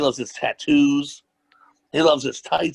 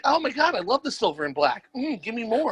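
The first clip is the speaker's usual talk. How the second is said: gushing fan voice; 7 semitones higher, 3 dB quieter than usual. Retold or imitated. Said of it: "you're like".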